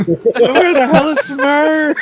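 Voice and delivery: high, slow frantic yell; panicked shout. Acted. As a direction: scream for your friend in panic.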